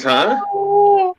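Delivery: high, startled "Huh?"; a stunned, squeaky huh as if totally lost; imitating a confused buddy's high huh; baffled huh. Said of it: "he goes".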